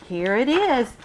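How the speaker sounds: usual speaking voice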